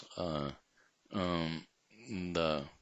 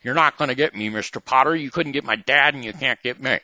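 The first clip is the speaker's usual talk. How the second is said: higher, louder, fast defiance; defiant quote; 6 semitones higher, 9 dB louder than usual. Retold or imitated. Acting George defying Mister Potter, loud and high.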